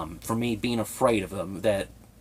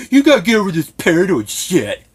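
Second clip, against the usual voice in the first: loud, high sneering mimicry; mocking jab; 9 semitones higher, 12 dB louder than usual. Family member taunting him after being blocked, loud sneer. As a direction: spit the jab back loud and scornful. Retelling their parting jab.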